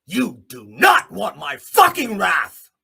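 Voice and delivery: loud, slow, each word hit; slow threatening shout. Real, not acted. Warning the board off, slow loud threat. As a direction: growl out the final threat.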